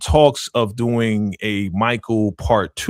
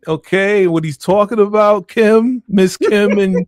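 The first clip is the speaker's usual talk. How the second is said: raised, louder, put-on formal speech to Kim; mock respectful address; 6 semitones higher, 5 dB louder than usual. Cohost speaking to Little Kim over here, mock formal. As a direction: talk straight to Little Kim like she's listening.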